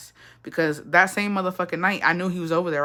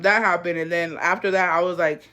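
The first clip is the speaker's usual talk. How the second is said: ordinary talk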